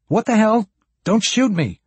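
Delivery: urgent high-pitched plea; alarmed protest. Acted. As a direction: burst out in disbelief at gunpoint. Gun lowered at him again.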